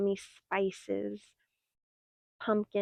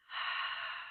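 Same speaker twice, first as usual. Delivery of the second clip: audible sigh; 10 dB quieter than usual. Acted. Relaxation closing exhale.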